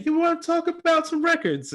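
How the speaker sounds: put-on high, tiny little girl voice; like a four-year-old girl asking; little kid's voice. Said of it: "me at four years old and my sister saying".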